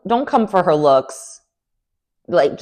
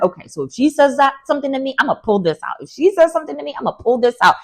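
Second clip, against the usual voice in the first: scheming plan voice; 8 semitones higher, faster than usual. Retold or imitated. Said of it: "I would go in there like".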